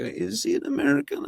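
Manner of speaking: high-pitched suspicious question; doubting viewer. Acted. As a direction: wonder aloud, voice rising high. Doubting viewer's reaction, acted out.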